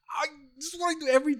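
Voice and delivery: high, fast incredulous outburst; exasperated. Retold or imitated. Son answers mom who asks for help, high fast disbelief. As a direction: voice flies up in disbelief. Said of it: "I was like".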